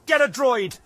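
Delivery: sick of being bossed around; fed-up outburst; ranting. Acted. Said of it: "she ranted".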